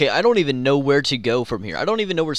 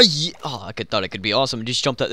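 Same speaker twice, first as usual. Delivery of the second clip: usual speaking voice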